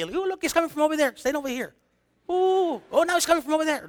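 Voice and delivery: high-pitched excited pointing-out voice; ooh-ing in pretend wonder; mock amazement. Mimes seeing the invisible wind.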